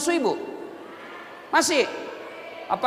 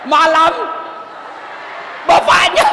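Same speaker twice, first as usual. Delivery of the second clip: shouted punchline word by word, much louder and higher; 5 semitones higher, 7 dB louder than usual. Acted. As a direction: boom out the punchline after the pause. Punchline after pause, big boost.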